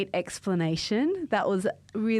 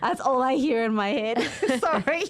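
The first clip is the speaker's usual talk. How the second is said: laughing; 5 semitones higher, 3 dB louder than usual. Real, not acted. Amused after robot impression.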